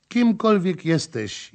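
high, slow pleading moan; moaning plea. Acted. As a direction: beg for mercy. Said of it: "jęczała". (jęcząca prośba)

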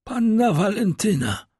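high, strained answer; name the person in a suffering high voice; dying man. A narrator's reading. Dying servant answers the doctor. (wysoki głos umierającego)

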